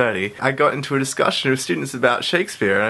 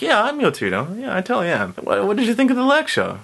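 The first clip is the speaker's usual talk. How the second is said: higher, breezy fake-teacher voice; playing the fake tutor, lightly pitched up; mock confident tutor; 8 semitones higher, faster than usual. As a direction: bluff like you really teach here. Pretending to be the tutor.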